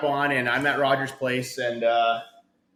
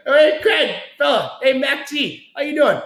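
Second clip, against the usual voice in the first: much higher and louder starstruck hail; excited greeting; 11 semitones higher, 8 dB louder, slower than usual. Retold or imitated. Fan spotting Craig McTavish in the lounge, excitedly hailing him. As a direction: greet your hockey hero like a starstruck fan.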